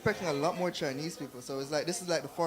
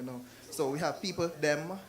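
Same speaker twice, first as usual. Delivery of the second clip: normal talking voice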